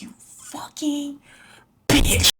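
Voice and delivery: whispered hiss exploding into a loud yell; hissed then yelled. Retold or imitated. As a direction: hiss it, then blow up on bitch. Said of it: "starts it all again".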